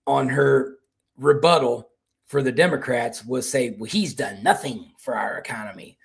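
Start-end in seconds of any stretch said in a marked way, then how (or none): 3.8-6.0 breathy mock whisper of a rival's line. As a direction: whisper it mockingly. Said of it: "that's what Abigail Spanberger did".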